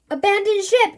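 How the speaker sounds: loud high shout of alarm; a captain shouting over the panic; yell. A narrator's reading. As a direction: bellow the order to leave the ship. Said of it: "yells the chief".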